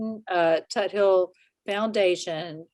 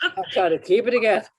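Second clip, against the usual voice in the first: louder, quick, laughing plea; laughing through emotion; 4 semitones higher, 6 dB louder, faster than usual. Real, not acted. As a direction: say it fast through a burst of laughter. Trying to hold back emotion, laughing.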